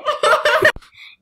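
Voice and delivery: squealing burst of laughter; high-pitched laugh. Real, not acted. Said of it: "désolée pour le rire, euh, un peu aigu".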